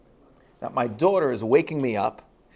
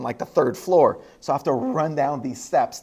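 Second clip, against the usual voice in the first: ordinary delivery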